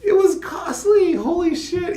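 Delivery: very high, loud, drawn-out outburst; exasperated exclamation. Real, not acted. Telling the audience about migration costs, high exasperated exclamation. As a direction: voice shoots up at the shocking cost.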